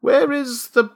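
high, slow, uneasy question; desperate. A narrator's reading. Father changes tack with clerk, desperate. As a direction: ask with a touch of desperation. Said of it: "shifting his ground desperately".

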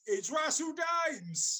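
announcer shout. Acted. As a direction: call the guest in like a game show host.

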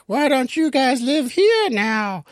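very high, drawn-out mock witch voice; hammed-up high witch invitation. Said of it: "the witch comes out and she's like".

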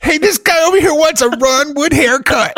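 high, loud taunting call to the room; mocking barber. Barbers laughing at a customer's haircut request, loud and high. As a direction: announce it to jeer at him.

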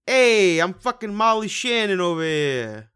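high, very loud, drawn-out Molly Shannon accent. Shown as a demonstration. Host showing off an accent, brash Molly Shannon impression. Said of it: "I could do a Molly Shannon accent".

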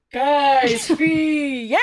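team name shouted high at the end; announcer yell. Acted. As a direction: call out the final team to the arena. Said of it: "the M C yells".

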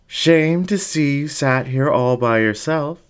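high, coaxing coo. Acted. Fox coos at the lonely dingo, high and sweet. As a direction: lure him in with a sweet coo. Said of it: "the fox cooed".

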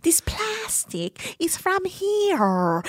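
slow, high mock voice with a laugh; mocking her. Quoting the woman, slow high mock voice. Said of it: "She's like".

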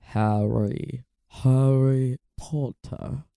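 slow, low, throaty drawl; Severus Snape. Shown as a demonstration. Host retrying the Snape impression, throaty drawl. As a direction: stretch the name out deep and deliberate. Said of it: "This is a throatier impression".

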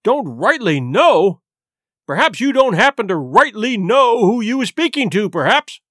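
loud, mocking echo of his words; scornful cry. A narrator's reading. Silver repeating Morgan's feeble answer, scornful and loud. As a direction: throw the answer back, stretched and scornful. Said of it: "cried Long John".

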